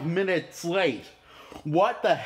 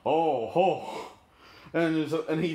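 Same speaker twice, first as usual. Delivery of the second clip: ordinary talk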